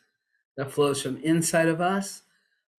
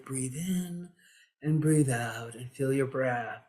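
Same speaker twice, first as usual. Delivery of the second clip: meditation teacher; 3 semitones lower, 5 dB quieter, slower than usual. Retold or imitated. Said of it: "so he's teaching meditation".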